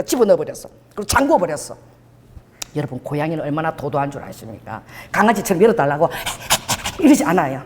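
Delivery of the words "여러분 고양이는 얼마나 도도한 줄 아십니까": ordinary delivery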